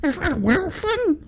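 creature voice. Pretend. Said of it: "Was he played by Tom Hanks?".

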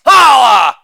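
loud exclaimed tag; big showy holler; shouted catchphrase. Acted. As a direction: belt it out as a punchline button. Shouted catchphrase after each bit.

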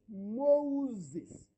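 "Moses" drawn out slowly, as Mo-uses; correct pronunciation. Shown as a demonstration. Preacher teaching the Egyptian name, "Moses" said slowly as Mo-uses. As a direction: stretch "Moses" into its parts, Mo and uses. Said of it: "the correct pronunciation should".

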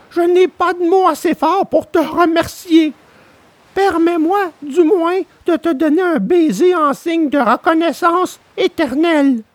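high puppet voice, touched and grateful; a boy thanking his rescuer, moved; moved Pinocchio. A narrator's reading. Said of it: "d'une voix émue".

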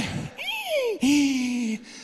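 high drawn-out wheezy squeal; imitated wheezing. Next day the sickness is back, imitated wheezing. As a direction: act out the breath tightening with a long squeal.

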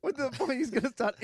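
slow, high laughter through the words; gasping out words between laughs; laughing. Real, not acted. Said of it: "I'm laughing because".

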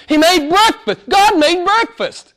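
preacher amazed that God made breakfast; awed emphasis. Real, not acted. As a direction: let the wonder build on breakfast. Preacher marvels, rising emphasis.

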